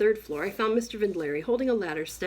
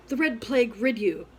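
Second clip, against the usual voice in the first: quick, louder Shakespeare curse spat out; loud and fast curse; 4 semitones higher, 4 dB louder than usual. Shown as a demonstration. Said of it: "say them loud and fast".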